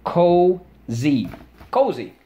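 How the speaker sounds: Cozy, called high and loud, then cozy. lighter; drill call. Shown as a demonstration. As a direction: lead the class in saying Cozy, brightly. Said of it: "let's go".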